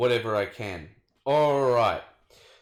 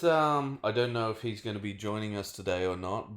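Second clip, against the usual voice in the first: ordinary delivery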